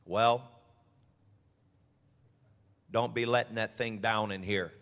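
a hint of a deep Midwest preacher; deep-voiced pastor. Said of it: "Had a real deep voice".

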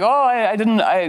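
touched surprise. Retold or imitated. Reformed bully cat thanking the storyteller, stammering surprise. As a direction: play a cat taken aback, stumbling over words. Voicing the cat's grateful surprise.

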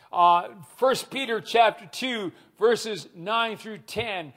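ordinary talk

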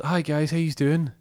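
put-on chirpy young cashier voice; cheery shop lad. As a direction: shop lad greets the late customers cheerily. Cheery shop worker greeting.

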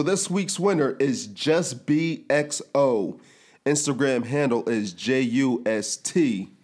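slow, spelled-out winner reveal; slow announcement. Acted.

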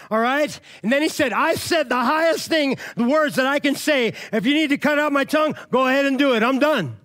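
high, loud preaching; preacher's climax, high and loud; full-force preaching. Acted. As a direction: preach the story at the top of your voice. Preaching the martyr story at full force.

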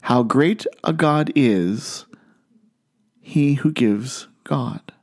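very slow, split by a long pause before "he who gives God"; measured reading with "great" drawn out, then a pause before "he"; careful enunciation. Shown as a demonstration. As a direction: spell out the tongue twister slowly, setting "great" and "God" apart. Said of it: "just the right amount of nun-, enunciation and inflection".

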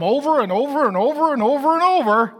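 "over and over" in a rolling, singsong chain; insistent repetition. Acted. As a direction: hammer each "over" in an unbroken, repetitive run. Voicing Holy Spirit's insistent repetition.

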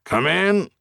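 mild call, pitched high. A narrator's reading. Warden calls to visitors behind the door, mild high call. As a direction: call it lightly through a closed door. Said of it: "a voice called from behind the door".

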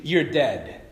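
ordinary talk, nothing marked

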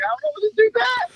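high-pitched mock voice, thrown by the other rider; confused rider. Rider retelling his confusion at the revving bike, in a high mock voice. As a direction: squeak it out, baffled. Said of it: "I was like".

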